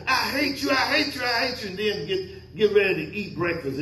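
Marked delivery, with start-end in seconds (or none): 0.1-1.7 high whiny mock tantrum, voice pitched way up; spoiled girl tantrum. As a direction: play a sulky little girl yelling at her parents. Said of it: "like a little spoiled girl that gets upset".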